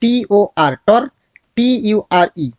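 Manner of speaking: TOR টর stretched very slowly; slow, clear drill repetition. Shown as a demonstration. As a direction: repeat TOR টর slowly for learners to copy. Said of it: "আবার বলছি".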